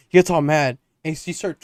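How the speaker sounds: ordinary talk, nothing marked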